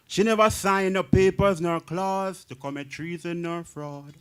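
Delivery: ordinary talk, nothing marked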